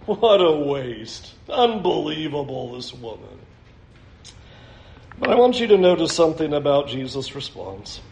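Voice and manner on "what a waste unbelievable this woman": indignant grumbling. Acted. Disciples grumbling to each other about this woman, low and indignant. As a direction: complain under your breath, trailing down softer. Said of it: "all kind of grumbling and indignant".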